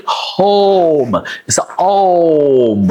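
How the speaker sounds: "home" stretched slowly on the O; slow, drawn-out "home"; long O home. Shown as a demonstration. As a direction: hold the O in "home". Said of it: "it sounds like a home".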